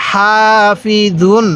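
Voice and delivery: slow, syllable by syllable হাসিদিন; slow recitation. Shown as a demonstration. Teacher reading the word slowly for students to copy. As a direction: draw each syllable out carefully. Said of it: "আরেকবার".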